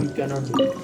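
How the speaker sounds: usual speaking voice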